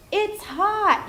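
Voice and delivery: put-on modeling for a child. Shown as a demonstration. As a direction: exclaim it for a toddler to copy. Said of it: "It might be like".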